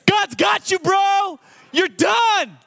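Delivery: very high, crowing delivery; gleeful retold taunt. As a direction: crow it in hysterical mock triumph. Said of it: "laughing my brains out".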